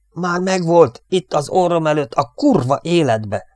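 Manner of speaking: high, loud angry cursing, slowing at the end. Acted. Jack curses losing the fighter, high and loud. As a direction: swear in helpless fury. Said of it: "szitkozódott Jack".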